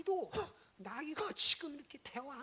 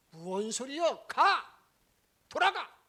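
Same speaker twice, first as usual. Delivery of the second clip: shouted order to go back; furious shout sending them home; loud dismissal; 4 semitones higher, 17 dB louder, slower than usual. Acted. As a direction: yell them away firmly. Balaam sends envoys away.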